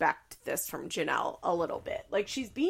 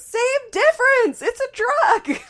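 very high, loud, drawn-out screech; mock screaming; 13 dB louder, slower than usual. Mom arguing that weed is a drug, mock screeching. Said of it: "Barb's screaming".

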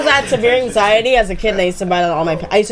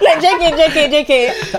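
laughing jk; 7 semitones higher, 3 dB louder, slower than usual. Real, not acted. Laughing joke.

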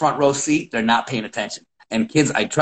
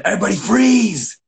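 higher called command to freeze; 7 semitones higher than usual. Retold or imitated. Coach calling out to jogging kids. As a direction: stop the whole gym at once.

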